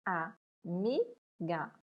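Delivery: Amiga split into A, MI, GA with pauses; syllable by syllable. Shown as a demonstration. Teacher separates syllables for pupils, Amiga one piece at a time.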